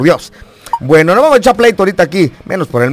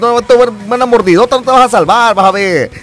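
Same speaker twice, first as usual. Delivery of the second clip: higher, fast teasing scold; mock scolding; 7 semitones higher than usual. Host teasing caller Goofy, mock scolding. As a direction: tease the caller to calm down. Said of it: "cálmate, Goofy".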